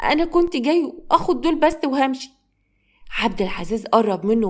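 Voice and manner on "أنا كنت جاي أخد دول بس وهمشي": put-on higher, small child voice; plaintive child explaining himself; pleading young boy. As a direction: confess meekly like a little boy.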